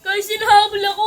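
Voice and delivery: fast frantic yell for help; panicked shout. Real, not acted. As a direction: shout while being chased. Being chased, shouting. (takot na sigaw habang hinahabol)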